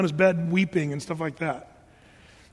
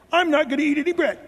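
put-on childish pout; 7 semitones higher, faster than usual. Ahab pouting like a child.

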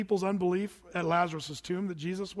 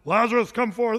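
loud commanding call; 8 dB louder than usual. Acted. Pastor acting Jesus, loudly calling Lazarus out. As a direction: call him out of the grave.

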